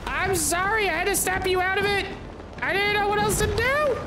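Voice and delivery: squeaky, fast sorry; high rushed apology. Real, not acted. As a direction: gush it out high and quick.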